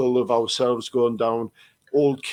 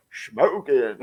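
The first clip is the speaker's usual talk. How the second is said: Jim Carrey impression. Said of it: "Jim Carrey and The Mask".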